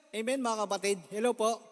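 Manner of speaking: put-on preaching to the faithful about childlike faith; fervent preaching. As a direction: drive the point home to the congregation. Preaching rising.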